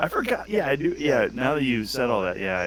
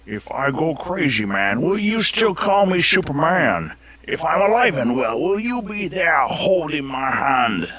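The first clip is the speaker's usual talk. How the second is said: put-on slow, high and loud, like a drunken pirate; a bad Connery singing a ballad; Sean Connery impression; 6 semitones higher, 8 dB louder, slower than usual. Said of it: "give me your Sean Connery".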